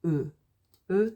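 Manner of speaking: "E" said as a rounded "euh"; unaccented French E. Shown as a demonstration. Teacher showing E on its own, said "euh". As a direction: say "E" like "uh" with rounded lips. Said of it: "If you see an E on its own".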